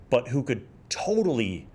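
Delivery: ordinary talk, nothing marked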